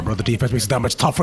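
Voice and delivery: ordinary talk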